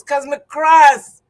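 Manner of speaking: slow, loud, cross words; cross outburst. Retold or imitated. Recalling sitting beside the landlady, loudly cross. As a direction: let the anger burst out slowly and loud.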